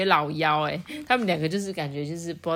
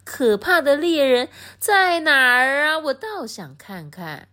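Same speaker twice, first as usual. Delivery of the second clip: mocking laugh; 9 semitones higher, 4 dB louder, slower than usual. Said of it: "大野狼就大笑".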